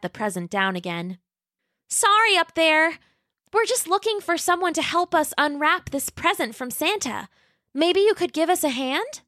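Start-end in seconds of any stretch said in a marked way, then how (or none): 1.9-9.2 put-on high, sweet little dinosaur girl voice; Roxy the young T-Rex. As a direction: play a polite, eager young T-Rex. Roxy calls up to nest.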